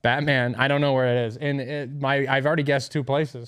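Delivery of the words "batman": BATMAN with both vowels as in "cat", American accent; plain American-accented BATMAN, falling at the end. Shown as a demonstration. Friend repeating it for the guesser, BATMAN in an American accent. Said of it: "say it with an American accent".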